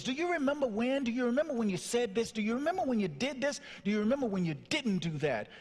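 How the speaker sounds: high, fast retold list of grievances; rampage. Son confronts his father on the phone, rampage. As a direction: fire off accusations in a high, rushed voice. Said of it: "I started this rampage, this list of things".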